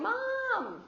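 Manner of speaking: put-on high, loud child's cry for mom; child calling out. Mother retelling the sick child calling down, high and loud. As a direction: call out like a sick child upstairs. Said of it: "The baby cries, going".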